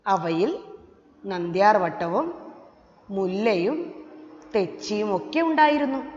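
phrase by phrase, with pauses between; measured reading, leaving room to follow; read-along pacing. Shown as a demonstration. Teacher has children read the story along with her, measured phrase-by-phrase reading. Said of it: "എൻ്റെ ഒപ്പം വായിക്കുകയും വേണം".

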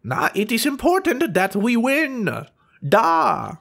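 high-pitched mock German rallying speech; rally them in a hammed-up German accent. Gamer rallying troops against Americans, mock German accent. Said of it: "try and rally the troops".

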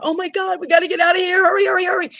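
high, hammed-up panic, hurrying; playful high-pitched panic; mock panic. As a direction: squeal it like terrified settlers. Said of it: "they're, like, freaking out".

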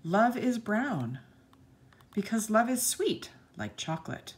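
put-on bright, sing-song read-aloud for children; lilting picture-book narration; storybook reading. A narrator's reading. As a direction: read the page like to a class of little kids.

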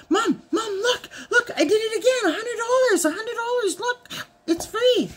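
put-on high, giddy childlike squeal; excited husband. Wife imitates husband boasting free reward, high giddy. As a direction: bounce with glee like a kid.

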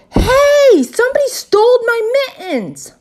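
high, loud outraged holler; alarmed shout. A narrator's reading. Girl finds her mittens gone, alarmed shout. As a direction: yell out the theft in outrage. Child discovers theft, cries out.

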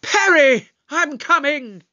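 loud, high-pitched calling out; urgent shout. Acted. Doctor running to rescue his companion, urgent shout. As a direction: shout to reach someone in danger. Answering companion's cry for help.

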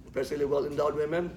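ordinary delivery

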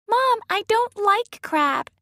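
put-on very high, slow child's whine; whining child. A narrator's reading. Shoshana whining to her mom about crab, very high and slow. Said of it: "whined Shoshana".

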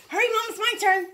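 high-pitched pretend pleading from a dog; impatient dog voice. Owner speaks for the waiting dog, high and impatient.

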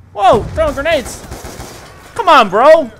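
high, loud, slow protest; exasperated shout. Real, not acted. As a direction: complain loudly at the enemy. Enemies throwing grenades.

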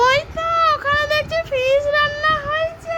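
squeaky complaining doll; high doll voice. Pretend. Toy friend spots fish. (উঁচু পুতুলের গলা)

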